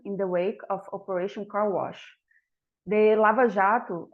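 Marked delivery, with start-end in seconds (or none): 3.2-4.1 Lava Jato said the Portuguese way, as a native would; Portuguese pronunciation. Shown as a demonstration. Speaker gives the audience the Brazilian name, in Portuguese pronunciation. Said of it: "as we say in Portuguese".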